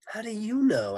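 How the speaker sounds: put-on high, loud kid question; child Paul's voice. A narrator's reading. Puzzled child asks the lunch lady, high kid voice. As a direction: ask in a high schoolkid voice. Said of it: "she sounded like Paul".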